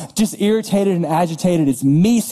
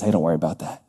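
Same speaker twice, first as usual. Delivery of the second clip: softer and lower, a calm unbothered God; gentle reassurance; 12 semitones lower, 5 dB quieter, much faster than usual. Pretend. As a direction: soothe the worrier like a father would. God voiced, calming the worrier.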